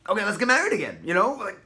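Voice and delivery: gleeful couple deciding to remarry; excited couple. Acted. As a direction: burst out with happy relief. Couple rejoices at pregnancy news.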